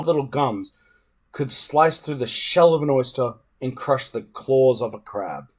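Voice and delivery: usual speaking voice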